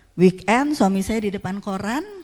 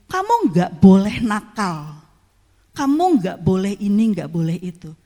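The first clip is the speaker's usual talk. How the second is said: a parent laying down the law; scolding a child; 7 dB louder than usual. Retold or imitated. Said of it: "kalau kita marahin".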